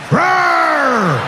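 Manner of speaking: high, loud mock monster roar. Meth addict springs up, hammed-up roar. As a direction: roar like a beast getting back up.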